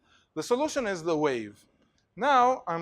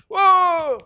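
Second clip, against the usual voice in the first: loud, high stadium cheer; big high shout of a cheering fan; cheering whoop; 13 semitones higher, 10 dB louder than usual. Retold or imitated. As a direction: whoop like a fan starting the wave.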